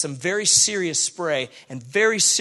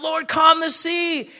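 pleading cry; 10 semitones higher, 3 dB louder, slower than usual. Retold or imitated. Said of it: "We cry out".

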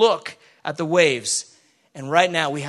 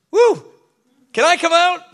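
high, loud excited call from the boat; voicing Peter, the preacher calls out high and loud, thrilled to step out; eager shout; 11 semitones higher, 8 dB louder than usual. Congregation hears Peter's eager high shout.

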